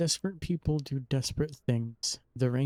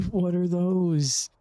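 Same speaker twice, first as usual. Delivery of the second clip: slow, loud, higher disbelief through a laugh; laughing surprise; 6 semitones higher, 5 dB louder, much slower than usual. Real, not acted. Streamer laughing at a kid walking around in pajamas, surprised.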